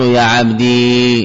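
يا عبدي said very slowly, stretching يا; slow model form. Shown as a demonstration. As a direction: draw out يا عبدي as the base form. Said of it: "أصل".

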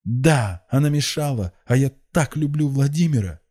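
high, loud lovesick outburst; crazed cry. A narrator's reading. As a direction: cry out madly in love. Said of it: "вскричала безумно Рассана".

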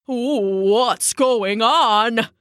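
slow frightened blubber. A narrator's reading. Bullfrog blubbering as the pond water swirls. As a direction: blubber it out, scared. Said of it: "blubbered the bullfrog".

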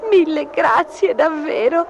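high, drawn-out tearful thanks; plaintive little kitten voice; sobbing. A narrator's reading. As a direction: thank her slowly, high and weepy. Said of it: "singhiozzò Gobbolino".

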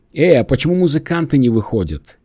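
calling out loudly; крик. Acted. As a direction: shout it across the room. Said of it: "потом крикнула Реми". (громко окликая)

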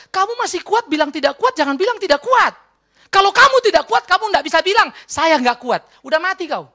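high, loud forceful rebuke; acted scolding. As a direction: re-enact a pastor telling off a counselee. Acts out scolding the counselee. (teguran keras bernada tinggi, diperagakan)

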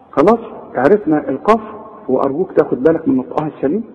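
usual speaking voice

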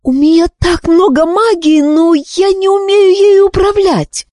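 high, desperate pleading voice; panicked. A narrator's reading. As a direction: voice climbing in panic. Wish's panicked thought.

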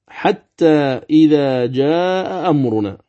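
slow, drawn-out tajweed recitation; Quranic recitation. Shown as a demonstration. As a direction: recite the verse slowly, elongating the vowels. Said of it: "أيضًا مثاله". (تلاوة مجوّدة بطيئة)

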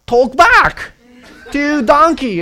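high, loud astonished telling; amazed emphasis. Real, not acted. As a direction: marvel at it aloud.

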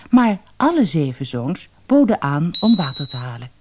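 ordinary delivery, nothing marked

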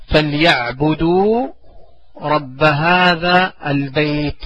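slow teaching recitation; slow drilled recitation. Shown as a demonstration. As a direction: stretch every word very slowly. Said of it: "teacher drills verse slowly".

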